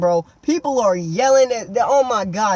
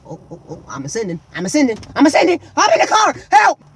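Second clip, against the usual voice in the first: mock rising panic; 3 semitones higher, 5 dB louder, faster than usual. Kid pretending he is ascending, building mock panic. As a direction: climb step by step to a shouted help. Acts out being lifted away.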